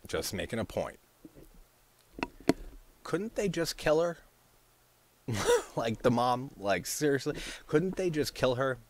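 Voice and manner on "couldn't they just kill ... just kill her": higher-pitched sarcastic question; sarcastic, high-voiced questioning; sarcastic suggestion. Real, not acted. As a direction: float a terrible idea sarcastically, pitched up. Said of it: "I'm being sarcastic here".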